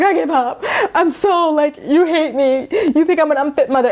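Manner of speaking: high, half-laughing mock whine; laughing, high mock of a dramatic mom; mock self-pity. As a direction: play her up as a wounded victim, laughing through it. Mocking Amber picking a fight.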